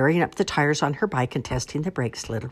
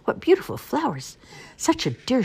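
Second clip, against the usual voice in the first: high, gushing wolf flattery; feigned delight; 11 semitones higher than usual. A narrator's reading. Wolf luring the girl to flowers, gushing. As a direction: gush sweetly to lure her away.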